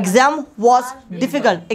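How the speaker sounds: slow, word by word, for the class to repeat; slow model sentence. Shown as a demonstration. Teacher drilling students, very slow and deliberate. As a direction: lay each word down slowly. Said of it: "teacher models sentence for repetition".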